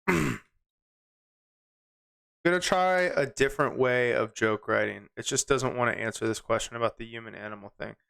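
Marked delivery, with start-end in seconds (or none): none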